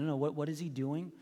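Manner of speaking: normal talking voice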